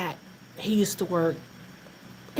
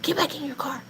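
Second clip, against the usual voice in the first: cop's hushed command; 5 semitones higher than usual. Retold or imitated. Cop warns them in the lot, hushed. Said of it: "He's like".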